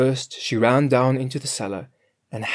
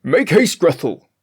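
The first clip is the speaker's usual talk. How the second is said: higher, louder master; master crying out; 6 semitones higher, 9 dB louder than usual. A narrator's reading. Master urging the cook to hurry, louder. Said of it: "cried he".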